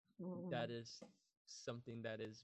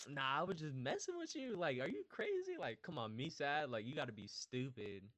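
higher, joking brush-off; mock dismissive; 5 semitones higher, 4 dB louder than usual. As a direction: laugh off the very idea, pitched up. Acts out brushing off sadness jokingly.

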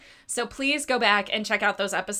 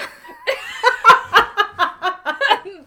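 loud laughing; 9 semitones higher, 8 dB louder than usual. Real, not acted. Laughing at description.

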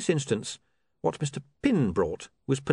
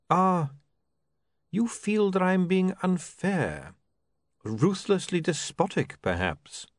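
higher, slow, drawn-out patrician; patrician feigning concern; 8 semitones higher, slower than usual. Acted. The patrician asks William if he is unfair, slow and mock-concerned. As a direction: needle him with slow, elaborate courtesy.

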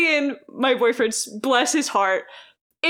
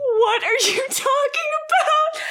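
high, slow disbelief through laughter; mock the officer's shock while laughing; a baffled officer, voiced through laughter; incredulous laughing. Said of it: "officer's just like".

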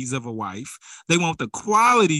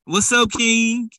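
mock greeting; 6 semitones higher, 5 dB louder, slower than usual. Imitating people greeting each other.

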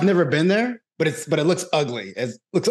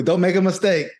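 ordinary delivery

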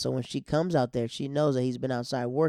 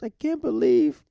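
high, loud hammed-up wail; put-on tearful cry; mock crying; 11 semitones higher, 7 dB louder, slower than usual. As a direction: imitate a girlfriend wailing in grief. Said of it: "And so she's crying".

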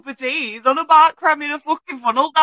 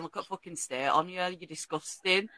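mock disgusted mutter; 5 semitones lower, 12 dB quieter than usual. Imagined scolding a cleaner.

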